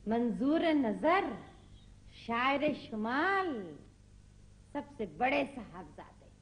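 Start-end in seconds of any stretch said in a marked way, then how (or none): 0.1-3.8 slow, drawn-out mock herald; mock grand announcement. Mother heralding her poet son, in mock grandeur. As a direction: announce each title with exaggerated slowness.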